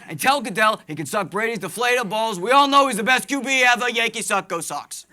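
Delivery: loud, thick Boston Batman rant; Boston accent Batman. Retold or imitated. Batfleck yelling orders at Robin, loud Boston accent. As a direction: bellow it like a wicked Boston Batman. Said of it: "full-on thick".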